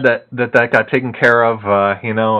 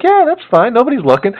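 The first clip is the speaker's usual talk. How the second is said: put-on much higher, bubbly helpful girl; lightly mimicked sweet store clerk; chirpy clerk voice; 11 semitones higher than usual. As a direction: play the cheerful night clerk. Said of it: "She's like".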